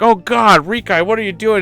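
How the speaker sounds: high, startled mock gasp; mock horror. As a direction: catch a friend in the act. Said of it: "I'm like".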